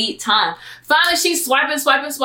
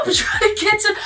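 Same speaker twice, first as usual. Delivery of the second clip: high crying shout; panicked; 10 semitones higher than usual. Retold or imitated. Narrator sprinting to the gate, high crying shout. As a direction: wail it out while running. Panicked dash through the airport.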